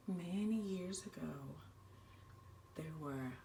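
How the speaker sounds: hushed, half-whispered scary story; spooky campfire story. Acted. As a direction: draw them in with a hushed campfire tale. Campfire ghost story told to scouts.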